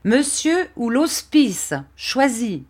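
slow, high-pitched threat; angry ultimatum. A narrator's reading. Barberin makes the boy choose, angry ultimatum.

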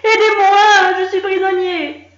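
high, loud trapped cry; panicked calling out. A narrator's reading. Gronchon, trapped, cries for help, high and loud. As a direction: beg anyone to get you out.

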